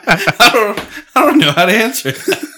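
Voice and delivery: high laughing words, can't get them out. Real, not acted. Cohost stumped by a silly question, laughing through.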